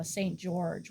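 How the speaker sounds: usual speaking voice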